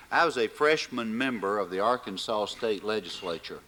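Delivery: ordinary delivery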